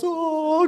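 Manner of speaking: high, loud fake-crying "oh"; mock sobbing wail. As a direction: wail in exaggerated self-pity. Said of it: "kita nangis".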